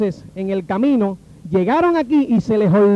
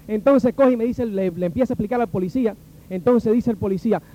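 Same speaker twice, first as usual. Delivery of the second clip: usual speaking voice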